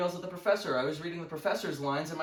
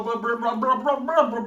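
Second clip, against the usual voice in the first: rapid-fire, raised-pitch blubbering noise; mock motorboating noise; 5 semitones higher, 7 dB louder than usual. Comic bragging about coffee, mock motorboating noise. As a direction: burst of rolling blahs.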